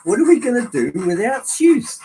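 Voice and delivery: high, loud lament; dramatic dismay. Real, not acted. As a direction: wail it as if all is lost. Laments loss of smoke unit maker.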